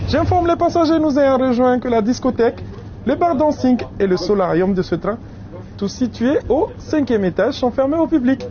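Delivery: joking train announcement; announcer voice. Acted. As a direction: deliver it like a conductor's announcement.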